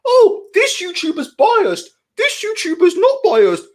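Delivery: high, slow, whiny mockery of critics; mocking the clowns. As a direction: sneer it in a silly put-on voice. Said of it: "these fucking clowns who go on about".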